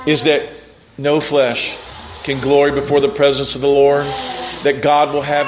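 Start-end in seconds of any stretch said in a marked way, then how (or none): none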